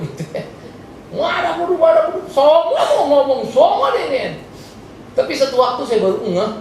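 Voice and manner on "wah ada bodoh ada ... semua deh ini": high, hammed-up ranting; mouthy friend. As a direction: lightly imitate a loudmouth, pitched up. Acts out a loudmouthed friend.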